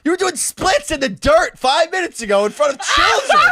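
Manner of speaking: high, loud mock outrage; hammed-up indignation. Storyteller throwing her hypocrisy back at her, high mock outrage. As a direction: rant it in a squealing, incredulous pitch. Indignant rant at retold accuser.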